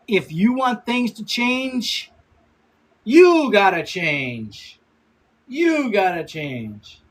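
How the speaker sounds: very slow, drawn-out quotation; slow emphatic motto. Retold or imitated. Streamer passing on a mentor's advice, slowly and emphatically. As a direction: stretch each phrase for weight.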